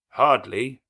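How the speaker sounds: a stranger halting the wedding; stranger's interruption. A narrator's reading. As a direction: cut the ceremony off firmly. Stranger answers.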